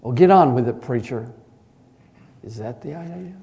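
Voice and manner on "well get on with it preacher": loud, curt get-on-with-it; a mocking jab at the preacher; mock impatience. Preacher imitates an impatient listener, loud and curt.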